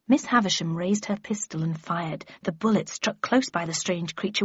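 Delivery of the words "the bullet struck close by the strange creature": usual speaking voice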